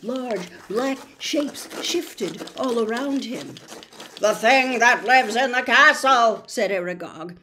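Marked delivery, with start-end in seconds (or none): 4.2-6.4 put-on loud, otherworldly old spider voice; looming spider elder, louder; ancient giant spider. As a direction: boom it out like a huge ancient spider. Giant spider Aragog speaks.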